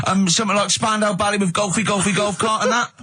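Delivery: hammed-up radio phone-in punter; eager phone-in caller. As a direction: play a hapless listener thrilled to get through. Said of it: "I wanted to get through and go".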